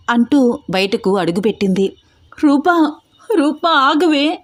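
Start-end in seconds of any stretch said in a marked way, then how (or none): 2.4-4.4 higher, drawn-out calls after a sister leaving; a raised, pleading yell of Roopa; desperate shout. Acted. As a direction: cry out her name to stop her, slowly. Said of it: "గొంతు పెగిలేలా అరుస్తోంది".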